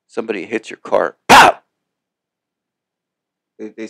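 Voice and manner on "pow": sharp high pow imitating a crash; crash sound. Telling listeners about a crash, a loud mock pow. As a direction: blurt the impact out loud.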